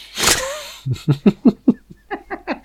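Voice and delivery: a high, bubbling laugh at his own pun; high delighted laugh. Real, not acted. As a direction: laugh high and free, tickled by the joke.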